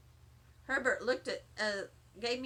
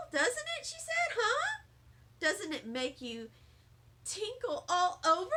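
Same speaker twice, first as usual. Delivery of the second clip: high insistent; 6 semitones higher than usual. Acted. Lucille pressing the kids.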